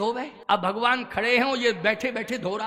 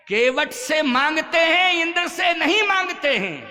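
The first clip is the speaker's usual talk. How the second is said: raised, louder emphatic contrast; emphatic preaching; 5 semitones higher, 5 dB louder than usual. Real, not acted. Preacher telling devotees whom God asks and whom not, emphatic. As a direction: drive the point home, voice lifted.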